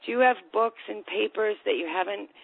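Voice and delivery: normal talking voice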